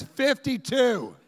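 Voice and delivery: mock audience answer, stretched out; audience guy answering. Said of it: "this guy goes".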